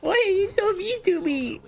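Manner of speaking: squeaky hurt high voice; whiny falsetto, acting wounded; high offended voice. Mock-offended at game insult.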